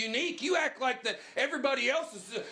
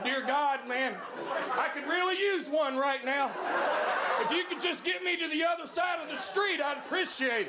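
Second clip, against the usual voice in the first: mock prayer; 6 semitones higher than usual.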